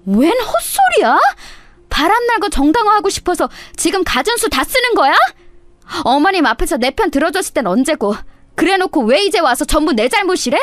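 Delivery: wife rejecting her husband's blame, high and outraged. Acted. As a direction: spit back at the excuses.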